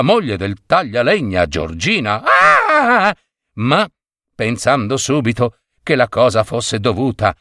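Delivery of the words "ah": high-pitched shriek of alarm; sudden scream. A narrator's reading. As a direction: let out a startled, high cry. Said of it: "Subito un grido uscì". (grido acuto improvviso)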